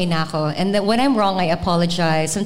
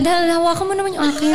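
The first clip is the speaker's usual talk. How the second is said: fast, laughing retold request; laughing quote; 8 semitones higher, much faster than usual. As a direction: rattle it off while laughing. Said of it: "Sabi ko kay Del".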